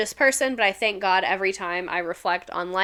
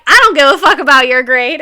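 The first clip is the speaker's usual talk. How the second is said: louder, laughing as she fires it back; loud laughing retort; 4 semitones higher, 12 dB louder than usual. Retold or imitated. Teacher firing back at a defiant student, loud and laughing. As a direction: throw it right back at them, laughing. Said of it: "And I'd be like".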